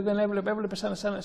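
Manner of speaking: normal talking voice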